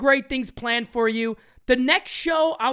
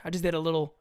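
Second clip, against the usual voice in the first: ordinary delivery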